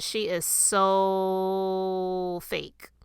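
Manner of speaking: "so" stretched out very long on a level pitch; drawn-out emphasis. Retold or imitated. Quoting an online critic of Meghan, "so" hugely drawn out. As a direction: hold "so" for a long beat before "fake".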